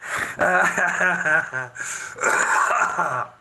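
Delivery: long burst of loud laughter; loud real laugh. Real, not acted. Bursts out laughing after rant.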